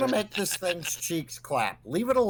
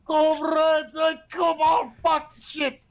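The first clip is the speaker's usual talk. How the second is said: high-pitched frantic holler; raised, strained rescue cry; urgent calling out; 11 semitones higher, 4 dB louder than usual. Acted. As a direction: call out to your comrades in a panic. Player calls to his allies.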